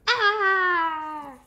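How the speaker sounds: loud, high choking cry; choking gasp. Acted. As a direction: choke on a burning mouthful. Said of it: "And almost choked".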